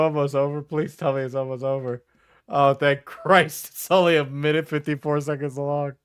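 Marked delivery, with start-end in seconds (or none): none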